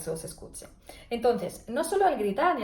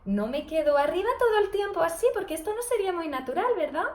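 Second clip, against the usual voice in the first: stuck up high; 6 semitones higher, 3 dB louder than usual. Shown as a demonstration. Therapist shows students a voice stuck high. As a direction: pitch held higher throughout, unnaturally. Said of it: "Yo subo y bajo cuando hablo".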